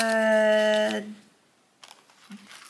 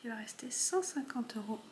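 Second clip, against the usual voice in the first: whispering; 13 dB quieter than usual. Real, not acted. Noting remaining amount.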